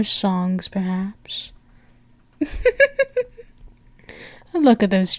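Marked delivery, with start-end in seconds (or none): 2.4-3.2 high, rippling little titter; light giggle. Acted. Princess delighted by her servant's blush, high teasing giggle.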